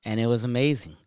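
normal talking voice

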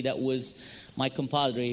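normal talking voice

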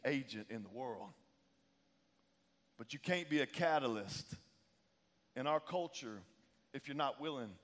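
grave, measured pulpit appeal; solemn preaching. Real, not acted. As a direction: let each phrase land, with pauses. Slows into grave exhortation.

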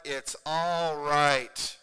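very slow, drawn-out reassurance; firm reassurance. Acted. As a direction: stretch the words out, loud and steady. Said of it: "come alongside of us and say".

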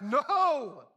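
crowd's shouted refusal, high on "No"; shouting back. Acted. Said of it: "they shouted back".

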